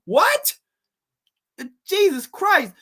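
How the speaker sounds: high, loud incredulous cry; exasperated disbelief. Real, not acted. Reviewer baffled by the scene, high and loud. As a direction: throw up your hands in disbelief.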